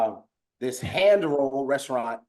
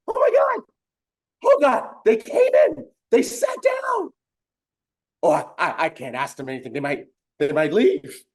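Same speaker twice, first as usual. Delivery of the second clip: high, frantic, breathless panic; nervous owner freaking out that guests came in; panicked owner; 8 semitones higher, 5 dB louder than usual. Retold or imitated. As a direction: squeal in a panic as guests walk in.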